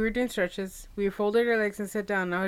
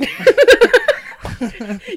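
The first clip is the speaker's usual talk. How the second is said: loud high cackle; 13 semitones higher, 12 dB louder than usual. Real, not acted. Girl cracking up over bonding in gym class, cackling. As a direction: burst of loud, high laughter. Cracks up at her own story.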